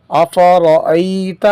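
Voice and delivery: slow, spaced word-by-word recitation. Shown as a demonstration. Teacher reading drilled words fluently for students, measured recitation. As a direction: read each word cleanly with pauses.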